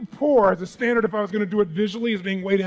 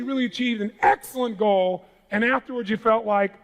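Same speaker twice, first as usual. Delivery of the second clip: usual speaking voice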